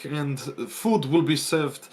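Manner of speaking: stiff, formal butler with a French touch; upright steward. Acted. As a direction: greet guests like a proud manor steward. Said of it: "comes out really upright and says".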